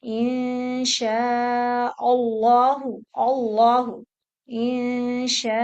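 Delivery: slow "इंशाअल्लाह" with a full, heavy lam in "अल्लाह"; heavy Allah. Shown as a demonstration. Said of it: "इसको heavy पढ़ेंगे".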